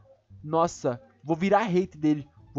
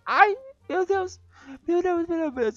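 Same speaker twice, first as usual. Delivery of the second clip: shocked cry; 11 semitones higher than usual. Real, not acted. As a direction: gasp it out in shock. Sudden hit in game. (gemido agudo e lento de susto)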